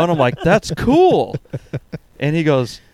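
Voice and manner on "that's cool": high-pitched retold "cool" reaction; high, drawn-out mock approval; put-on enthusiasm. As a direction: act out a strained reply, pitched up. Retold reply to friend's gloating text.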